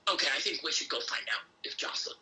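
whispered raspy smoker's voice; husky whisper like a heavy smoker. Retold or imitated. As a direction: friend with a smoker's voice whispering a plan. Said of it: "this voice like this, smoker's voice".

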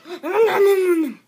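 loud, high chomping sounds breaking into a laugh; munch noisily while giggling; laughing nom nom. Pretend. Acting out eating, laughing.

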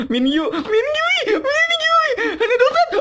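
very high, loud fangirl shriek with laughter; real squealing, half laughing, half crying; ecstatic screaming. Real, not acted. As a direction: scream the name in overjoyed disbelief. Fan screaming at favourite pull.

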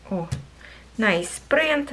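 usual speaking voice

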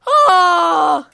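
long, loud, high "oh"; startled cry. Real, not acted. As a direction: cry out as a giant appears. A giant appears.

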